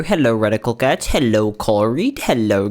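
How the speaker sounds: ordinary delivery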